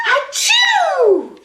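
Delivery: high, loud shouted achoo; huge sneeze. Acted. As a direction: blast out a giant sneeze. Gerald's biggest sneeze.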